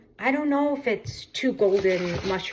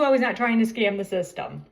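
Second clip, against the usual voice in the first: ordinary talk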